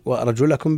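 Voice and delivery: وأرجلكم with an a after the lam, "wa-arjulakum"; fatha reading. Shown as a demonstration. Teacher cites Nafi's reading, وأرجلكم with "la". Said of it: "بالنصب".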